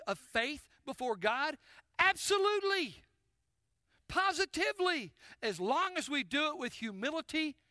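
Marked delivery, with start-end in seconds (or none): 2.0-5.1 emphatic affirmation. Real, not acted. Emphatic answer to his question.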